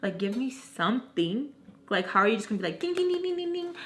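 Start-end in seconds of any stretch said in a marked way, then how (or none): 2.8-3.7 high, level run of dings; imitated dinging. Complaining about being messaged right away, high imitated dinging. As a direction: mimic a high-pitched alert chime. Mimics rapid demanding response.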